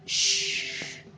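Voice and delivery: soft whispered shh; long shush. A narrator's reading. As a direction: hush someone for being rude. Old man shushes the old woman.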